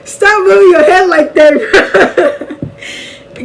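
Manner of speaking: giggling as she scolds her friend; laughing. Real, not acted. To her friend beside her, laughing while teasing.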